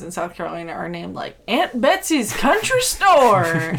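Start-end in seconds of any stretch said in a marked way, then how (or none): 1.5-3.8 slow, drawn-out mock store name; hammed-up store name. Podcaster mocking South Carolina liquor store names, hammed-up and drawn out. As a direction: stretch it out like a grand title.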